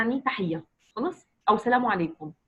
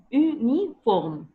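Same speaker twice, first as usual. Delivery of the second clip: uniforme stretched out slowly; slow demo word; much slower than usual. Shown as a demonstration. Teacher showing a pupil how to say uniforme, slowly. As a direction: draw out uniforme syllable by syllable. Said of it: "comme ça".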